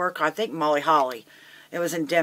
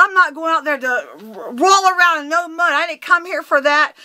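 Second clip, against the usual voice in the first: loud, high-pitched yelling; indignant, explosive shouting; furious outburst; 12 semitones higher, 7 dB louder than usual. Retold or imitated. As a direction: tear into him at the top of your voice. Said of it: "I just blew up".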